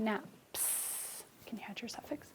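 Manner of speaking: whispered prompt. Real, not acted. Quiet prompt to child.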